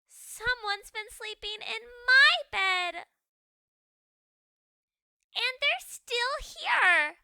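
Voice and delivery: high, loud, slow crying little ghost; baby ghost. Acted. Baby ghost finds Goldilocks in bed, loud crying. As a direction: sob it out like a scared child.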